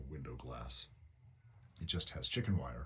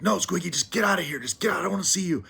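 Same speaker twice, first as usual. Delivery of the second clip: very loud, high-pitched furious yell; angry shouting; 12 dB louder, faster than usual. Retold or imitated. Owner sending Squiggy away after she came back, angry shouting. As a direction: yell at the pet, fed up and hangry. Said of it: "I shouted really loud".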